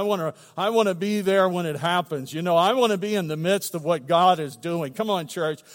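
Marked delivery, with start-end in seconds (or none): none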